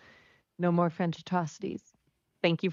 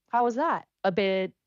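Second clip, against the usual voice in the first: normal talking voice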